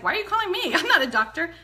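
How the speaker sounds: surprised quote. Retold or imitated. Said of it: "I was like".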